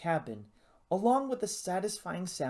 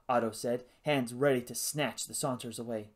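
usual speaking voice

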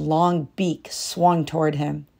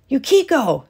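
calling her name, higher; 9 semitones higher, 4 dB louder than usual. A narrator's reading. Husband calling his wife home, higher call. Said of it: "he called".

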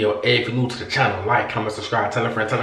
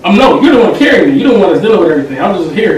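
sarcastic; 6 semitones higher, 11 dB louder than usual. Acted. Mocking self-deprecation in argument.

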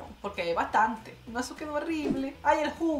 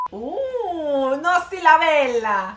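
slow, loud shocked exclamation; loud disbelief; 4 semitones higher, 10 dB louder, much slower than usual. Real, not acted. Vlogger aghast at a candle on a nail, slow and loud. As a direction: drag it out in disbelief.

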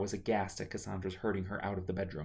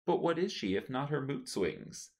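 chiming in; 6 semitones higher than usual. Acted. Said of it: "Merkener chimed".

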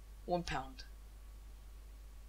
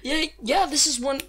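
higher and louder, fired up; hyped shouting; 7 semitones higher, 8 dB louder than usual. Real, not acted. Streamer hyped about the skin he pulled. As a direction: shout it out, buzzing. Hyped after the big pull.

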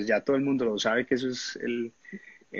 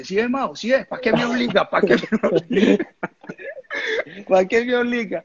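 high laughing mock complaint; whining teammate; 10 semitones higher, 3 dB louder than usual. Teammate who missed blaming Mao, high laughing whine. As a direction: whine it back at him, laughing. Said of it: "cuando él vuelve y me dice".

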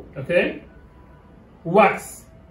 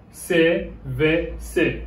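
slow spelled letters; much slower than usual. Shown as a demonstration. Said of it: "teacher spells letters slowly".

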